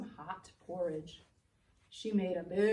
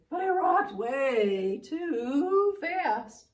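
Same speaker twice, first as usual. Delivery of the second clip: loud drawn-out too fast; 5 semitones higher, 11 dB louder, much slower than usual. A narrator's reading. Rocker too fast, acted.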